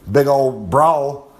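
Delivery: put-on very slow big old brawl; drawn out. Old Master warns of a movie brawl, very slow.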